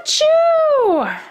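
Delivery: huge high sneeze, very loud; loud hammed-up achoo; big sneeze. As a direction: explode into a sneeze. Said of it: "makes the bear".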